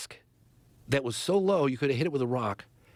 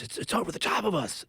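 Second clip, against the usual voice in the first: freaking out; 6 semitones higher than usual. Retold or imitated. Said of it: "they're freaking out".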